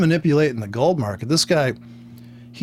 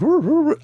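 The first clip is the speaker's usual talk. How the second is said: very high, louder wailing roo roo; mocking siren howl, pitched way up; imitated American siren; 13 semitones higher, 3 dB louder than usual. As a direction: wail like an American police siren. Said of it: "what is with the American siren?".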